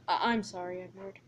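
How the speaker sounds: low, soft, faltering apology; sheepish apology. Acted. Percy apologises to Edward after his stunt, sheepish. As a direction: shrink into a quiet apology.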